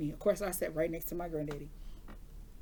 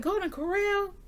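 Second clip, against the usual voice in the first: high-pitched, surprised echo; incredulous question; 12 semitones higher, 4 dB louder than usual. Retold or imitated. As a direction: repeat it back in mock disbelief. Questioning grandma's restaurant choice.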